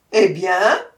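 loud, rising demand; impatient tiger. A narrator's reading. Tiger eager to devour the brahman, impatient. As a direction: shout it as an impatient question. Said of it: "s'écria le tigre, impatient".

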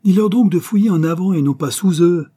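high, loud authoritative yell; yelled order. A narrator's reading. Archaeologist orders the foreman about the diggers, yelling high and loud. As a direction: holler the order down at the workers. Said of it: "hurla Finucane en arabe avec un geste autoritaire".

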